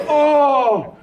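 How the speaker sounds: a high, hammed-up "Oh!" as [name]; mock [name] exclamation. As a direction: play [name]'s big surprised gasp for laughs. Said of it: "he's like".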